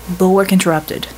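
ordinary delivery, nothing marked

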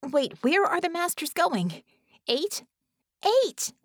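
high, agitated questioning, building to a yell; frantic, high-pitched demand; urgent calling. A narrator's reading. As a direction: call out with mounting alarm. Bella calls to silent channel.

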